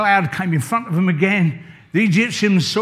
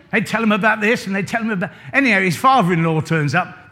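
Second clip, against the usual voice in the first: normal talking voice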